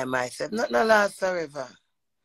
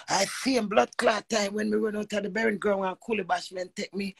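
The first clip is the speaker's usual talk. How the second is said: normal talking voice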